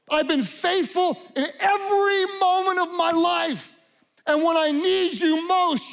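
Jesus crying out from the cross. Retold or imitated. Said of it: "Jesus on the cross cried out to God".

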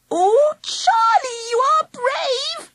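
very high, slow gushing cry; adoring cry. A narrator's reading. Angela gushes at Charlie for saving her, crying out. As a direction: gush with relief and admiration. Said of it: "she cried".

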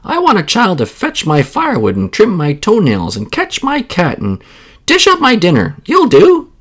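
put-on high, loud, rising demanding old woman; a greedy witchy crone claiming the child; rising to a high, loud crow; bossy old woman.

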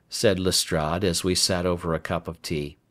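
plain reading voice

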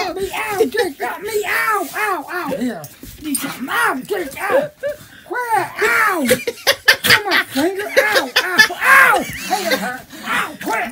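high, loud, laughing ow; yelping in pain. Real, not acted. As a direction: yelp and laugh while bitten. Dog biting during scuffle.

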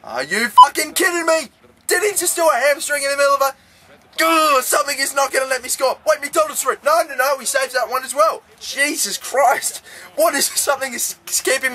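high-pitched, frustrated disbelief; exasperated outburst. Real, not acted. Frustrated at failing to score.

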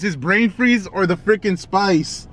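ordinary talk

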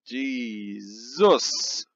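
ordinary talk, nothing marked

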